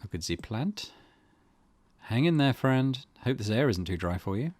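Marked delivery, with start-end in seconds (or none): none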